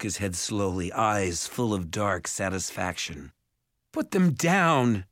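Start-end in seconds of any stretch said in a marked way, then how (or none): 3.9-5.1 high, drawn-out desperate cry; panicked. A narrator's reading. Mother demanding the ogre release them, panicked. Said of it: "mother's voice was panicked".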